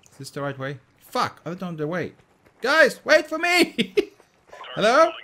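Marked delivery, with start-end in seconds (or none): 2.6-5.1 high, loud, drawn-out plea; calling out. Real, not acted. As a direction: shout after teammates leaving you behind. Calls after teammates.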